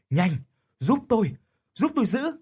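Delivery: high, loud, fast frantic yelling; urgent shout. A narrator's reading. Old man shouts to relatives for help, frantic loud yell. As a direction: scream for help holding the coffin lid. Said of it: "miệng hét lên".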